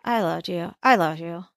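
lightly imitated, lips barely moving; deadpan "I love you", mouth nearly still; flat ventriloquist delivery. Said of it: "this is exactly how he says it".

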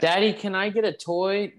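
put-on kid voice. Mock kid asking dad.

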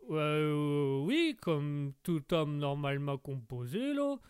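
put-on slow, hesitant Québécois accent; wary Québécois lumberjack. Québécois guest puzzled by the host's question, wary Québécois accent. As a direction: answer warily in a broad Québécois accent.

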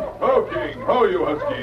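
high ringing call to halt the huskies; calling to the dogs. Acted.

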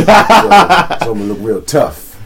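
slow, puffed-up tough-guy voice; hammed-up tough pose voice; mock tough guy. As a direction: act hard, drag it out. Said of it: "That tough look".

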